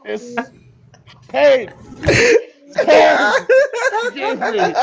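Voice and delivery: high, loud pained cries; hammed-up anguish dissolving into a breathless squeal; mock agony. Begging forgiveness through the pain, mock agony.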